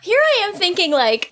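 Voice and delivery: usual speaking voice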